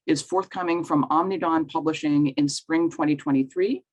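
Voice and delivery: ordinary delivery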